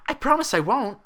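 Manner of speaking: high, loud eager vow; eager promise. A narrator's reading. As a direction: promise it brightly.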